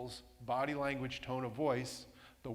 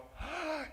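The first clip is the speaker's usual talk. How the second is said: sharp, high delighted gasp; high gasp of glee; excited gasp; 14 semitones higher than usual. Shown as a demonstration. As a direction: gasp like you just saw something wonderful. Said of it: "I can get up there and go".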